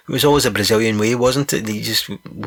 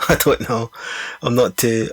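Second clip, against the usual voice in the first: usual speaking voice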